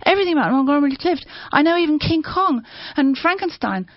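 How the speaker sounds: high, loud, rushing list of names; shouted out. A narrator's reading. Prisoner at gunpoint shouting film stars to his captor, loud and high. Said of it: "I shouted out".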